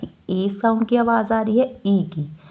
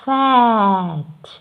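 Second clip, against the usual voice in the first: slow sounding out; much slower than usual. Shown as a demonstration. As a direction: drag "फैट" out very slowly after spelling "F ए T". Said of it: "फैट में ए की आवाज़ आ रही है".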